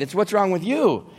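ordinary talk

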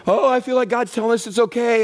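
high, loud mimic of someone excusing themselves; mock high-pitched quote. People in a relationship justifying themselves, high mock voice. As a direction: squeak it out like a naive churchgoer. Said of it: "they're in the middle of a relationship".

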